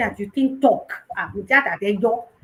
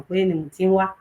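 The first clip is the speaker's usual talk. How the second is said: ordinary talk, nothing marked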